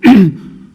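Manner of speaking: sharp throat clear. Real, not acted. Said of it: "pardon my scratchy voice".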